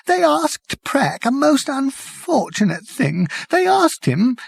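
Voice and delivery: high, slow, weeping whisper. Acted. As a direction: whisper through tears. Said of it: "he said in a weeping whisper".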